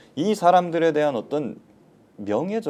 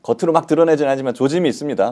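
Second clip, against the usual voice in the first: usual speaking voice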